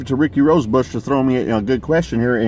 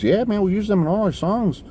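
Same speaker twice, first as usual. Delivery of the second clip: high-pitched mock Mexican accent; rough Mexican accent; 11 semitones higher than usual. Stranger explains his band's habit to the musician, high mock Mexican accent. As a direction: imitate an easygoing reply in a put-on Mexican accent. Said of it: "how to do a good Mexican accent".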